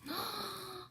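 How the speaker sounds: quiet surprised gasp; sharp hushed intake of breath; soft gasp. Acted. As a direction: catch your breath in suspense. Magic moment about to happen.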